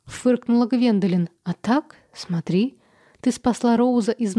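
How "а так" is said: plain reading voice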